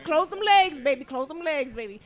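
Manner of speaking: usual speaking voice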